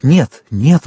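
urgent exclamation of denial; exclaiming. Acted. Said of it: "воскликнул тот".

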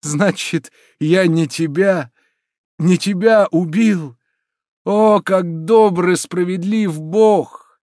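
high, loud, slow exultation; rapturous, drawn-out thanksgiving; delirious joy. A narrator's reading. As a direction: cry out in overwhelming relief. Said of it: "в пылу безумной радости".

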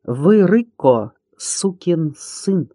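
each word spelled out slowly; distinct. A narrator's reading. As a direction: say it slowly and crisply, every word clear. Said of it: "отчётливо сказал воспитанный Доня". (отчётливо и медленно, по словам)

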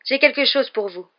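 urgent, lifted hail; breathless call. A narrator's reading. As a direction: call it out as if winded. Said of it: "la voix haletante".

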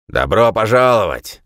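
shouting over rotor noise; calls out loudly over the roar. A narrator's reading. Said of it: "крикнул Рид".

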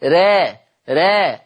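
را said alone with a thin light r; thin ra sound. Shown as a demonstration. Teacher isolating the letter sound, thin ra. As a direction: model the single sound را thin. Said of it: "أنا عايز أسمعها را".

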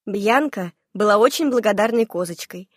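ordinary delivery, nothing marked

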